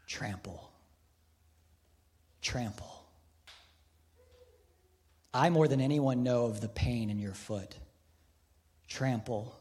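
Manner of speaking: a lightly voiced, quiet Christ, soft and low. The bronze Christ speaks to the priest, soft and low. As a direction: murmur it gently, like a voice from the bronze. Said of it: "the Christ in the bronze speaks".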